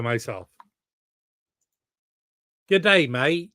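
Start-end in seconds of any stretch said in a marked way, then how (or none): 2.7-3.5 a loud, higher mock Australian greeting; cheery mock Australian accent. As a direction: hail the chat in a put-on Australian accent. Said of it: "I didn't know you were from Australia".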